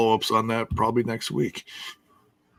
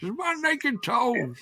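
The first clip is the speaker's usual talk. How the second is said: mock wrestler promo; 3 dB louder than usual. Acting a wrestler's threat promo.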